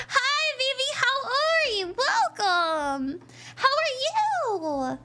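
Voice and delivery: high, excited greeting; gushing welcome. Real, not acted. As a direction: greet them in a high, delighted voice.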